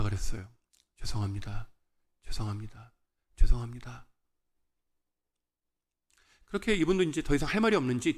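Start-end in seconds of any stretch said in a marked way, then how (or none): 1.0-4.1 low, hushed, meek apologizing; subdued apology. Retold or imitated. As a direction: apologize softly under someone's anger. Retold repeated apology to angry man.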